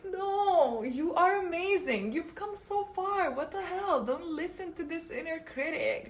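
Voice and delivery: high, gentle pep talk to yourself; tender self-encouragement. Retold or imitated. Hugging herself and cheering herself on, high and gentle. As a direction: hug yourself with your voice. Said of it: "I give myself a big hug and I'm like".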